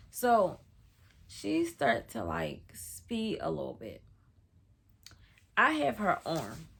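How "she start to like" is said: usual speaking voice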